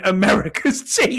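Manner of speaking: high, loud mock shout; imitated background shouting. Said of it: "Burrows is in the background shouting".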